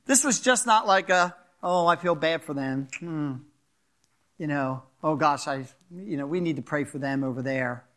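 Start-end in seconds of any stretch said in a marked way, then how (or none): 1.6-7.9 mock shallow pity. Mocking a shallow sympathy.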